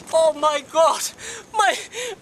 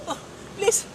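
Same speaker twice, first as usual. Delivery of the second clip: normal talking voice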